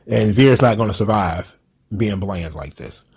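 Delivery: normal talking voice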